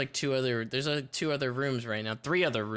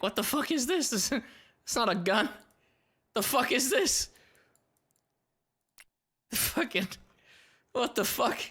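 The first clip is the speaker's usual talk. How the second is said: mock disbelief; 9 semitones higher than usual. Mock baffled at odd listings.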